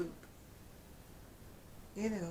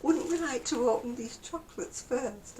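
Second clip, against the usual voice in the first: tag imitating advert woman; 6 semitones higher than usual. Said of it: "And she goes".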